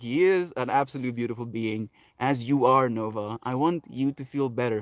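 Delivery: ordinary delivery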